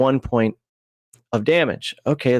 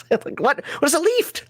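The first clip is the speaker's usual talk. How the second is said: mock confusion; 11 semitones higher than usual. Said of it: "mock confusion".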